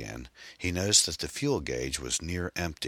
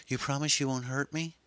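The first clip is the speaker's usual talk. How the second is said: put-on high, timid girl's voice; a narrator's high, fearful young woman; frightened young woman; 11 semitones higher than usual. A narrator's reading. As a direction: ask it small and scared.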